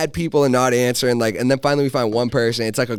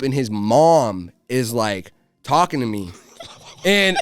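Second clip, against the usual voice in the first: ordinary talk, nothing marked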